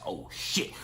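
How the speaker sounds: whispered under the breath, no voice; hushed curse. Retold or imitated. On the phone with mom, spotting a cop car: a whispered curse. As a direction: breathe the curse out so no one hears. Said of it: "trying to keep my voice down".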